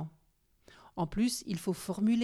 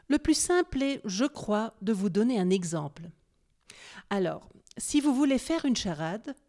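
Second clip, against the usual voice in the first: usual speaking voice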